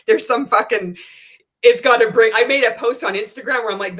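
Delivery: usual speaking voice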